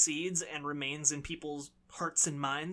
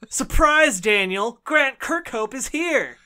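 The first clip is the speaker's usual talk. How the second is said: loud, high game-show reveal; mock announcement; 7 semitones higher, 12 dB louder, slower than usual. Host pretending Grant Kirkhope joined the podcast, loud and high. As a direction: announce the surprise guest with fanfare.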